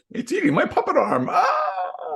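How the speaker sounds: very high laughing puppet yelp; laughing puppet cry. Pretend. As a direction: squeal through laughter as the puppet. Playing the puppet being bitten.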